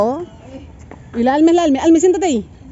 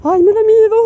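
playful fright; 8 semitones higher, 6 dB louder than usual. Real, not acted. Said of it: "tengo un trauma".

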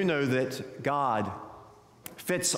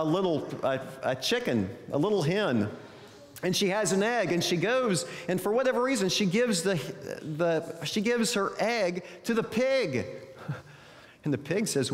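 lively, higher-pitched storytelling; animated storyteller with swooping pitch; children's story narration; 5 semitones higher than usual. Acted. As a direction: read it like a picture book to young children, voicing the animals. Tells a children's story aloud.

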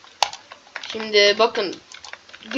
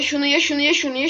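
normal talking voice

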